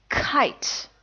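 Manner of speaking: Kite with a strong puff of air on the k, nearly whispered; aspirated k. Shown as a demonstration. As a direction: blow air out hard on Kite. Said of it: "like the K in kite".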